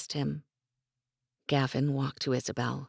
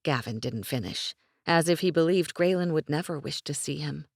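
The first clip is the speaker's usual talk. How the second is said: plain reading voice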